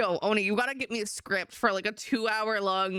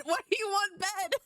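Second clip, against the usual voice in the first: much higher, teasing squeak; mock squeaky question; 10 semitones higher than usual. Teasing a friend in a high squeaky voice. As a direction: squeak it out like a cartoon.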